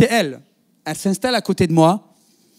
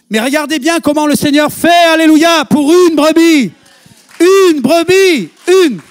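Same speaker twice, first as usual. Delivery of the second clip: much higher, louder, slowing to a cry; fervent preaching; 9 semitones higher, 8 dB louder, slower than usual. Real, not acted. Preacher stressing God cares for one sheep, loud and slow. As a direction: preach it at full force, drawing each word out.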